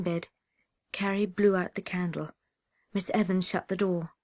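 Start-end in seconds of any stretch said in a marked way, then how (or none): none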